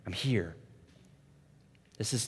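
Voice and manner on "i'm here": tender reassurance. Retold or imitated. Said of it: "he presses in and says".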